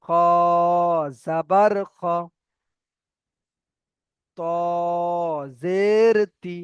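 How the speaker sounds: held "খ" and "ত", short final syllables; spelling demonstration. Shown as a demonstration. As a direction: stretch each letter name slowly. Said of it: "তাহলে এখানে পড়তে হবে".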